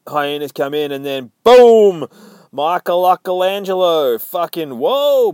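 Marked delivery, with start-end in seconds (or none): 1.4-2.1 loud, drawn-out impact shout; explosive boom. Acted. Reviewer narrates a sudden entrance, loud explosive shout. Big sound effect in retelling. 2.5-5.3 high, loud excited announcement; hyped-up reveal. Acted. Reviewer reveals Michelangelo bursting in, high excited shout.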